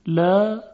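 لا with a long, light fronted a; thin lam. Shown as a demonstration. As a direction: say لا as a thin "læː".

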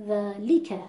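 ذالک with a hard push on li; exaggerated accent on the middle syllable of ذالک; overstressed lām. Shown as a demonstration. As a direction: punch the li in ذالک. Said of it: "فشار بیارم روی حرف ل".

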